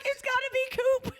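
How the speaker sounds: very high, squealed certainty; excited insistence. Retold or imitated. Insisting on guessed name, squealing.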